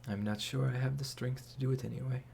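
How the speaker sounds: weak whisper from the floor; barely able to speak, lying down; collapsed on floor. Acted. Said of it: "you hear from the other side of the door".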